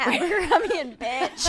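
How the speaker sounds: high, laughing diva jab; diva taunt. Retold or imitated. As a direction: deliver it like a sneering diva.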